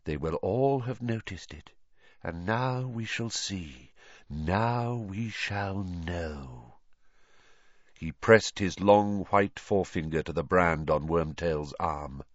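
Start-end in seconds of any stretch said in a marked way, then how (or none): 0.1-6.8 quiet. A narrator's reading. Voldemort softly continuing.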